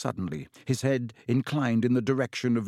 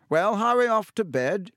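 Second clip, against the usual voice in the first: kindly stern teacher, raised pitch; a curious headmaster's question; older wizard voice; 9 semitones higher, 4 dB louder than usual. A narrator's reading. Dumbledore sends Riddle off.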